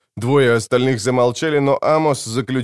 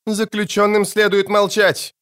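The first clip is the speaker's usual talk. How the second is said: much higher-pitched, sharp command; barked order; 10 semitones higher than usual. A narrator's reading. Warden behind the prisoners, barking for silence. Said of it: "рявкнула позади них Рона".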